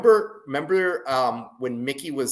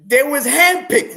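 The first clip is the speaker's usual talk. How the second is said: mock Mickey; 11 semitones higher, 9 dB louder than usual. Echoing the Mickey quote.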